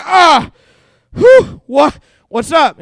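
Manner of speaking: loud, high flustered gasp into a question; mock startled yelp. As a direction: jump out of your skin, then cover it up. Startled again by wife.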